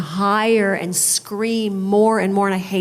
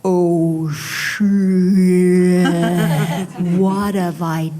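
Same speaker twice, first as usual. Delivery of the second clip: dawning panic; 4 dB louder, much slower than usual. Retold or imitated. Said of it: "the penny dropped".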